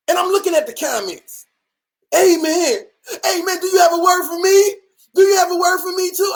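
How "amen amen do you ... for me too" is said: mock commenter. Imitating eager commenters.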